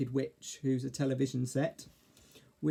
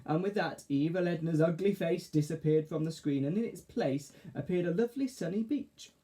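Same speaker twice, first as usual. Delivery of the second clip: usual speaking voice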